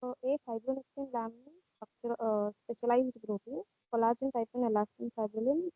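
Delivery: low voice, hard to hear; very quiet. Real, not acted. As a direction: answers almost too softly to catch. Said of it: "your voice is very low".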